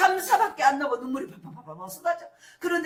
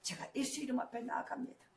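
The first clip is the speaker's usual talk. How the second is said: quiet prayer; 10 dB quieter than usual. Retold or imitated. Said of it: "기도하기".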